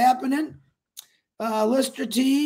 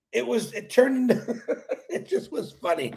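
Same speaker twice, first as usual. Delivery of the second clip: laughing it out; 2 semitones higher than usual. Real, not acted. To his live viewers, giggling at the deadline mix-up. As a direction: laugh through the words and let them trail off. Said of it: "And I'm laughing".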